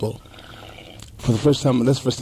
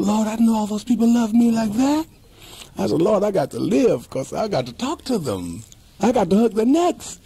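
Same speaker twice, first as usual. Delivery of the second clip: higher, pleading, rising with fervour; fervent prayer; 9 semitones higher than usual. Retold or imitated. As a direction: beg the Lord to let you live. Retold prayer begging to live.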